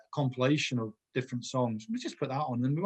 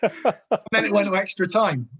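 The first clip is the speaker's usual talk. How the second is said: laughing; 5 semitones higher, 5 dB louder than usual. Real, not acted. Laughing along at the story.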